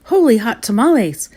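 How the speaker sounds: high, loud, amazed exclamation; startled exclamation. A narrator's reading. Kitty boy shoots past his reflection, exclaiming. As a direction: exclaim it with pitch jumping up. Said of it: "exclaimed Skippy John Jones".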